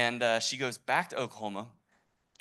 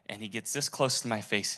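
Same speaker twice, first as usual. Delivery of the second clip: plain reading voice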